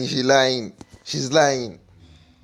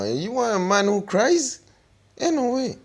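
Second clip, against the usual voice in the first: incredulous mockery; 9 semitones higher than usual. Real, not acted. Mocking disbelief at a crying man.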